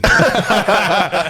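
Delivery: big burst of high laughter; loud laugh. Real, not acted. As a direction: crack up hard at the joke. Answer to a cheeky question.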